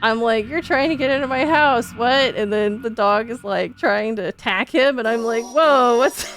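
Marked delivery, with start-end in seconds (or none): 0.5-2.3 higher and louder mock outrage; mock disbelief. Retold outrage at intruder. 5.4-6.2 louder startled cry; startled whoa. Retold or imitated. Retold alarm at door.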